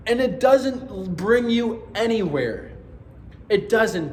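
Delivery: pleading in frustrated anger, pushing every line out; got a little mad. Real, not acted. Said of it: "I got a little mad there".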